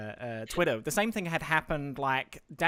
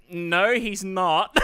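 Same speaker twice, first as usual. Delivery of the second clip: loud, very slow mock correction; slow, pointed denial with a laugh; drawn-out retort; 3 semitones higher, 6 dB louder, much slower than usual. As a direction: stretch it out emphatically, amused. Acting out butting in on chat.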